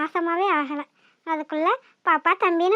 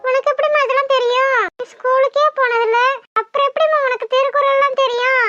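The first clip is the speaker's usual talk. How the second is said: mocking; 5 semitones higher, 6 dB louder than usual. Daughter mocking mother's wisdom.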